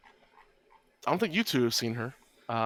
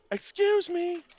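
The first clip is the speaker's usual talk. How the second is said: high, loud calling out; high-pitched woman's voice; 5 dB louder, slower than usual. Acted. Woman shouting at campers from afar, high-pitched voice. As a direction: call out shrilly high as an indignant woman. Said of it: "in a high-pitched woman's voice".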